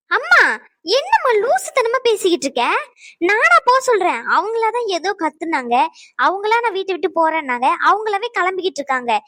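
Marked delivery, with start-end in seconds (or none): 0.1-4.2 high, raised voice in exasperation; indignant protest. Acted. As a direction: snap back at an unfair accusation. Daughter protests in family quarrel. (கோபமாக எதிர்த்துப் பேசும் குரல்)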